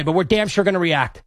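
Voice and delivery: ordinary talk, nothing marked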